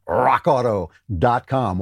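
"rock auto dot com" with ROCK rolled out dramatically; showy brand-name delivery. Shown as a demonstration. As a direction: relish "rock auto dot com", drawing out the first word. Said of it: "just by the way I say it".

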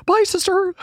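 high, sing-song farewell; mock Tati line read. Quoting the famous apology video sign-off, high and sing-song. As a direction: bid her goodbye with fake sweetness. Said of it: "perfect line read".

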